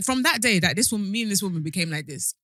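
usual speaking voice

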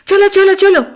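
urgent high loud chalo; hurrying. Acted. Anu rushing the narrator into moving, urgent high call. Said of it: "తొందర పెట్టింది".

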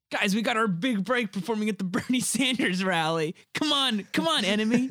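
hammed-up excited pep talk; mock excitement. Acting an excited band member, joking.

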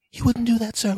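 put-on very high nervous kid; mock kid voice. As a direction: squeak it like a worried punk kid. Kids voiced pleading.

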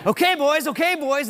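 high-pitched mock cowboy call; bull rider calling out. Preacher plays rider at the gate, high mock call. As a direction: call it up high as if ready in the chute.

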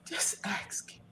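very soft, almost whispered plea; hushed urging. Real, not acted. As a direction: drop to a near-whisper to urge gently. Urging host to ask dad.

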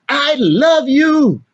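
high, slow, drawn-out I love you; slow loving declaration to a child; tender I love you. Pretend. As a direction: say it like a father who means it. Father telling child he loves them.

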